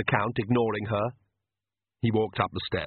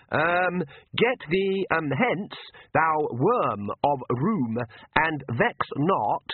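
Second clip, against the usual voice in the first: higher, very slow halting recital; hesitant priest; 5 semitones higher, much slower than usual. Acted. Oates reading an exorcism at the Count, halting and slow. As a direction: stumble through the words read from a book.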